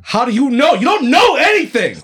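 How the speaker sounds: high, loud shout; father shouts at brother over daughter, high and loud; angry outburst. Acted. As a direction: snap back at him in anger. Husband argues with the brother.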